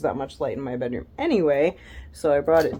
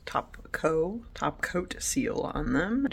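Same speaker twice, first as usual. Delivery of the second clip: whisper; 4 dB quieter, slower than usual. Real, not acted. Muttered aside to herself.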